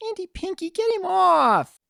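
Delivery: a tiny filly wailing for help; pleading filly. Acted. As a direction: beg her aunt to pull him off. Filly begging aunt for help.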